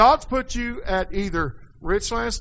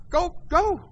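high-pitched, weary sending-off; exasperated permission; 10 semitones higher, 3 dB louder than usual. Retold or imitated. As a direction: wave them off, pitched high. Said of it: "Like I told that member of ours".

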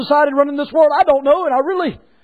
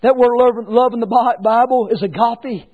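ordinary talk

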